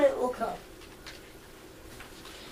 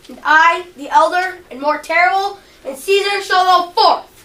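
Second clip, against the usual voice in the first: louder, building a proud defiant declaration; defiant boast; 2 semitones higher, 7 dB louder than usual. Acted. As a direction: proclaim your might to your wife. Caesar declares he is fearless.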